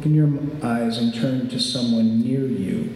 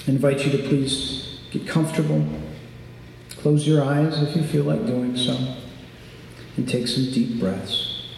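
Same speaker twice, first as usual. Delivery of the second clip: calm grounding exercise; 3 semitones lower, 5 dB quieter than usual. Shown as a demonstration. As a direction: guide them gently through breathing. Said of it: "end with a little exercise".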